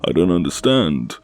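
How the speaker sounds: panicked, loud, high pleas; frantic pleading. Acted. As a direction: beg frantically to be let go. Kidnapped girl pleading frantically.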